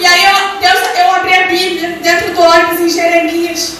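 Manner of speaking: loud, high, impassioned preaching. Real, not acted. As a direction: build to a shouted climax. Preaches at full force, climaxing.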